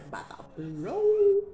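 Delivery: high, slow squeal on about to blow; stomach about to blow. Retold or imitated.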